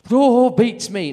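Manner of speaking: high, loud, slow dithering mum; old mum voice. Retold or imitated. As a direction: play the clueless mum loudly.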